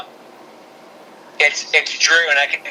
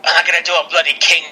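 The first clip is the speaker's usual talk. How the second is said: Drew, attempted Scottish accent; 3 semitones higher than usual. Podcaster imagining the wrestler refusing a king gimmick, bad Scottish accent. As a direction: have a clumsy go at a Scottish accent. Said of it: "I think there's some Scottish way back".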